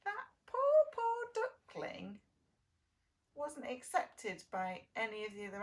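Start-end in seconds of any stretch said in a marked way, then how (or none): 0.0-2.2 ache over the word poor; pitying. Real, not acted. Teacher pities duckling.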